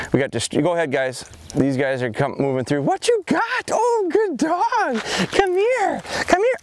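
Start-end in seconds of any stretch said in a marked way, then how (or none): 2.8-6.6 very high, sing-song puppy talk; high dog-praise voice. Real, not acted. Trainer calling puppy to come.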